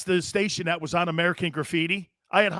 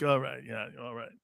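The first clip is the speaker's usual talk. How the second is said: low, soft half-hearted agreement; unimpressed acceptance; 5 semitones lower, 8 dB quieter than usual. Retold or imitated. Host retelling his lukewarm reaction to a legend, unimpressed. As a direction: mumble it lower, fading away.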